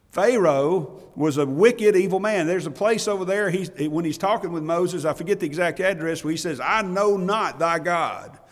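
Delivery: loud, high, stretched-out pulpit preaching on wickedness; full-force preaching. Acted. Preacher thundering to his congregation about wicked Pharaoh, full force. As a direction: drive the point home like a revival preacher.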